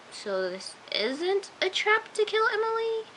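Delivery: slow, high-rising question; surprised doubt. Retold or imitated. Viewer realizing the meeting is genuine, re-enacted surprise. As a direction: imitate dawning disbelief, climbing in pitch.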